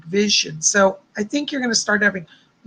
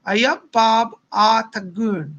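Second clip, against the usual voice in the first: slow, halting go at Northern English dialect; attempted Northern English accent; slower than usual. Host reading a viewer's dialect greeting aloud, attempted Northern English accent. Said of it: "if I said to you in my accent".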